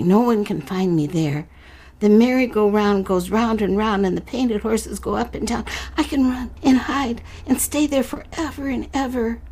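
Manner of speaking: imagine the hiding place with longing; dreamy child. Acted. Carney dreaming of the carousel, light eager voice.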